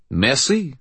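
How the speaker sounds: put-on high French-accented thanks. A narrator's reading. Brother thanks the guard, French accent. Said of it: "in that annoying French way".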